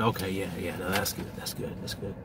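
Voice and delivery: whispery, trailing off, talking himself down; hushed reassurance to himself in bed, fading; soft self-soothing mutter. Retold or imitated. Man lying awake in bed, soothing himself, softly. Self-talk while trying to sleep.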